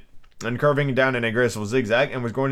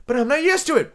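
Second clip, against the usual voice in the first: high, plaintive little plea; piteous pleading. Acted. Alice pleads with the caterpillar, piteous and high. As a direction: plead pitifully, pitched well up. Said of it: "pleaded poor Alice in a piteous tone".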